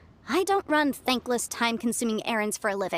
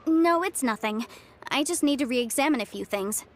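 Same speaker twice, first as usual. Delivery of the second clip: ordinary talk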